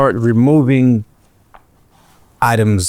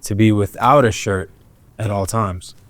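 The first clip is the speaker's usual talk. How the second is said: ordinary delivery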